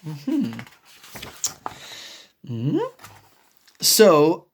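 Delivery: usual speaking voice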